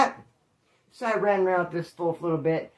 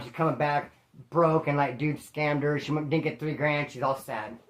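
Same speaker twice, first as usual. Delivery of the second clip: ordinary talk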